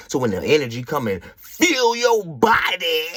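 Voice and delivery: crying out, fervent; impassioned preaching. Acted. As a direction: preach it with fire. Preaching about energy.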